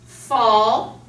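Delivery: "Fall" with air pushed out on the f; pushing air out. Shown as a demonstration. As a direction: breathe out hard on the "Fall" f. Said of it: "you're pushing air out".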